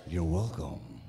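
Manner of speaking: very low robot leader voice; deep heroic robot reply; Optimus Prime. Shown as a demonstration. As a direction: answer as the giant robot. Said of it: "Thank you, Optimus".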